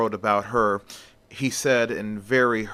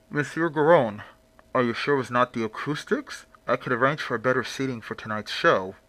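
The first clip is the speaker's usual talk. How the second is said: mysterious stranger voice; faster than usual. Acted. Hidden figure offers better seating.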